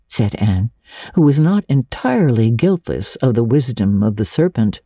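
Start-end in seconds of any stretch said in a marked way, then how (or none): none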